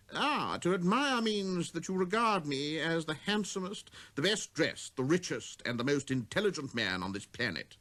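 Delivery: fishing eagerly for praise; conceited man. Acted.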